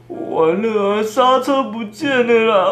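high, slow panicked wail. Retold or imitated. Axiang panicking that his brakes vanished, high slow wail. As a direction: moan it out like he's lost all hope. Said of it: "他嘴里一直说".

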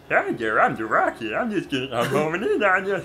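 high, put-on cartoon voice; silly character voice. Game boss greeting the players, silly high voice. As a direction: announce yourself as a goofy game character.

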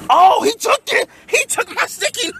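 shocked surprise. Real, not acted. To viewers as the man grabs his note, high stunned surprise. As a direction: voice jumps high in stunned disbelief.

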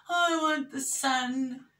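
high, slow pretend complaint; put-on drawn-out whine; mock whining. Mimics complaining about cloudy day.

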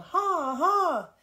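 loud, high repeated driver's cry; yelled command. A narrator's reading. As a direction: yell the turn as the sled tips. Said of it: "Almanzo yelled".

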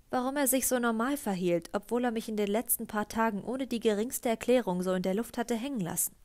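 plain reading voice

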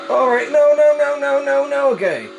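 high frantic repeated no; panicked pleading, voice shooting up; panicking gamer. Real, not acted. Car being overtaken in race.

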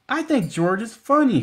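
put-on high, delighted child; a child tickled by the monkey. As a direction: say it bright with glee. Melissa laughs, then speaks.

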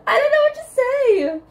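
very high, loud helpless protest; high-pitched flustered cry; squealed protest. Real, not acted. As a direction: squeal back in mock defence. Protesting back at friend.

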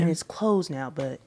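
breathy whisper. Real, not acted. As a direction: whisper it softly. Sad news, aside.

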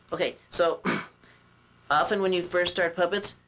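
ordinary talk, nothing marked

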